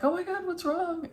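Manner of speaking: worried friend. Retold or imitated. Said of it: "and he's like".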